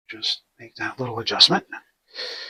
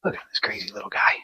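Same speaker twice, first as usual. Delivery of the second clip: whisper; 9 dB quieter, faster than usual. Real, not acted. Whispering about the rabbit.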